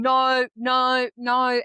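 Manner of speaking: body's insistent refusal. Retold or imitated. Body refusing the job during interviews, insistent protest. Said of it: "in my body was going".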